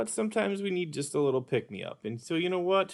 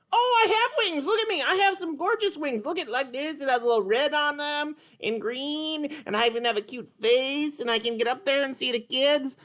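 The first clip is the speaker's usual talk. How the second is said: high, bright puppet character, eager; higher cartoon sidekick voice, eager; Petrie puppet voice; 9 semitones higher than usual. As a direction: play the little pterodactyl, keen to try.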